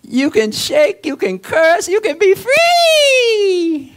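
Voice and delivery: building from loud to a high crying shout on free; preaching at full force. Acted. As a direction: climb each phrase until free breaks into a shout.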